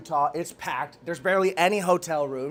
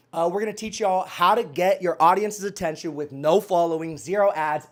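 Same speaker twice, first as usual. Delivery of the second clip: ordinary talk, nothing marked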